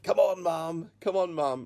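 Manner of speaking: loud, high retold plea; urging son. Son urges his tearful mum, acted loud pleading.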